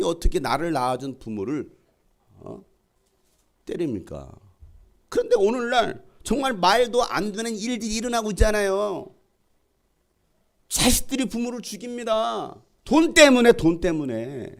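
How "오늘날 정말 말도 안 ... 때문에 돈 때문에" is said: high-pitched, lamenting pulpit cry; decry it to the whole congregation; impassioned preaching. Real, not acted. Pastor decrying children who kill parents over money, impassioned preaching.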